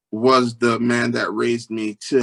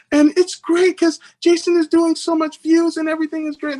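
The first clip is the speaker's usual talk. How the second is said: imitating a gushing wife, very high; high-pitched retold bragging about a husband; enthusiastic wife; 4 dB louder than usual. Wife telling parents his good news.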